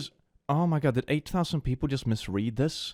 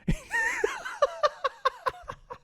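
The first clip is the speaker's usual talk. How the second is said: high-pitched laugh; 5 dB quieter than usual. Real, not acted. Laughing at the meme.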